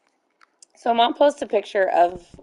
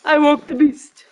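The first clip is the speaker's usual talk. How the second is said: raised, loud proclamation; mock-dramatic announcement; 5 semitones higher, 5 dB louder than usual. After waking the sleeper, mock-dramatic proclamation. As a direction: announce it high and loud, playfully.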